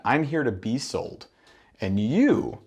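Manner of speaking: normal talking voice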